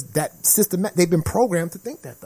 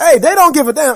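loud insistence; 8 semitones higher, 8 dB louder than usual. Real, not acted. Repeating the outburst.

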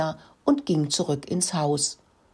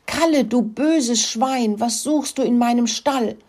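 angry hen; 6 semitones higher than usual. Acted. Hen catches pig wrecking her nest.

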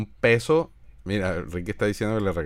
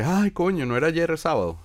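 higher, dazed voice of a late friend; imitating Juan Carlos; 6 semitones higher than usual. Mocking friend Juan Carlos showing up weeks late, higher dazed voice. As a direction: play the clueless guy who lost track of days. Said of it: "Juan Carlos llega tres semanas después".

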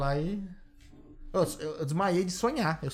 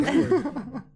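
laughing; 9 semitones higher, 3 dB louder than usual. Real, not acted. Self-mocking joke, laughing.

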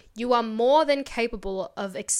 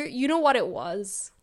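ordinary talk, nothing marked

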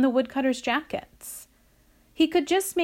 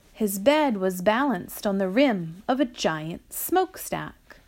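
ordinary delivery

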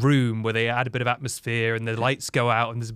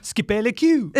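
hammed-up mock French accent; 8 semitones higher, 4 dB louder, slower than usual. Dad telling his family to skip the queue, mock French accent. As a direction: fake French, loud and high. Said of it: "In French".

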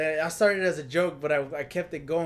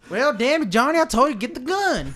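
country pa; 9 semitones higher, 5 dB louder than usual. Pretend. Country pa role.

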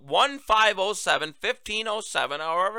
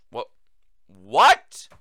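loud rising disbelieving what; incredulous what; 8 semitones lower, 9 dB louder than usual. Real, not acted. Host reacting to the alcoholic claim with loud disbelief. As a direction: sound stunned at the claim.

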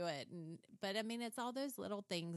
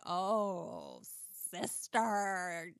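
warning mom; 6 dB louder, much slower than usual. Retold or imitated. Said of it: "I'm like".

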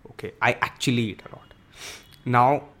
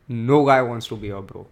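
normal talking voice

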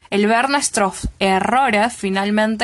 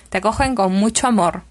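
usual speaking voice

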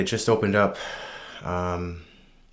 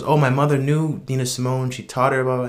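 excited boast; 5 semitones higher, 4 dB louder than usual. Retold or imitated. Homeless woman boasting about [name].

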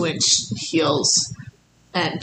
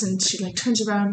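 ordinary delivery

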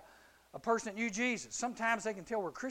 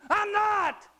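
loud, much higher panicked denial; 13 semitones higher, 10 dB louder than usual. Retold or imitated. Peter blurting a denial to the girl, loud and high.

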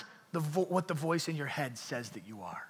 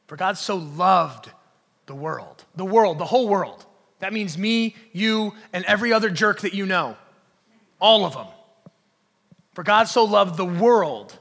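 loud, emphatic preacher delivery; forceful preaching; 3 semitones higher, 11 dB louder than usual. Real, not acted. Preacher stressing God's love for the whole world, forceful preaching. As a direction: drive the verse home, punching key words.

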